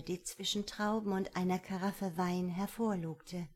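ordinary delivery, nothing marked

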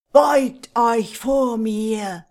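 slow, loud commanding roar; undead king. Acted. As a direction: command them to kneel. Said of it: "schrie er".

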